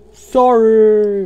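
high, loud, drawn-out shout; yelled apology. Pretend. Gamer calling out an apology, high drawn-out yell. Sudden yell while playing a game.

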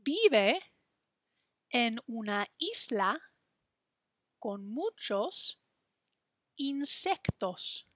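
slow, phrase by phrase, with long pauses; slow choral rhyme. Shown as a demonstration. Teacher leading students through the rhyme slowly. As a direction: lead the rhyme bit by bit for the class to echo. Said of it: "say it with me".

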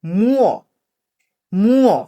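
"মুও" drawn out as one gliding word; pronunciation demo. Shown as a demonstration. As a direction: model "মুও" slowly for learners. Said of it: "আমরা আবার উচ্চারণগুলা করি".

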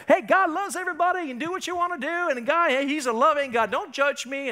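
hammed-up cheerful permissiveness; mock feel-good preacher. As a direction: play the carefree everything-goes believer. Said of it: "He's just a big Barney in the sky".

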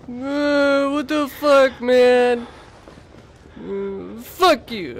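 high, loud frightened shrieks; panicked screaming. Real, not acted. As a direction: scream as you're caught. Grabbed by enemy.

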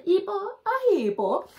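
high, slow, drawn-out hippo; silly hippo voice. Pretend. Reader naming a hippo picture, high and slow.

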